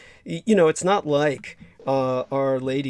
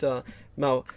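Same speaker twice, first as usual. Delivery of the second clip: ordinary talk, nothing marked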